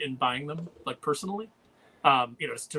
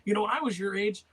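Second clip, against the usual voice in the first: nerdy guy lecturing; 8 semitones higher than usual. Retold or imitated. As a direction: lecture the kid like an eager nerd. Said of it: "he's telling him".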